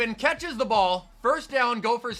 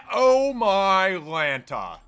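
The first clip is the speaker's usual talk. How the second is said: stunned; 5 dB louder, much slower than usual. Real, not acted. Reacting to a touchdown threat.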